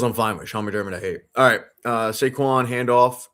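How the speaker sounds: normal talking voice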